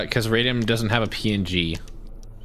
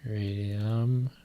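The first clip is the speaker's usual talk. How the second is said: whisper; 7 dB quieter than usual. Acted. Whispering a character name.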